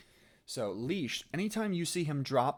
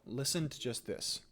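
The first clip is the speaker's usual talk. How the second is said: normal talking voice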